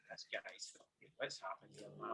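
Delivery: barely voiced, under the breath, slowed; muttered whisper of a scared passenger; hushed whisper. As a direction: whisper it so the driver can't hear. Panicked thought in stolen car.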